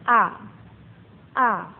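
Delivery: "A" short and light, with no stress; light neutral tone. Shown as a demonstration. Teacher modelling the light neutral tone, short and unstressed. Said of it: "cingseng nada ringan".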